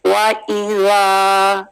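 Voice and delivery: slow, held vowel in "वा इज़ा"; two-count vowel. Shown as a demonstration. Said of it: "दाल की हरकत टू". ("वा" को दो हरकत तक खींचें)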